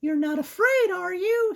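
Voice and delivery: put-on squeaky girl mouse; high-pitched cartoon mouse. Voicing Sarah.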